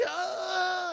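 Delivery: Luke in pain. Retold or imitated. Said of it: "he's like".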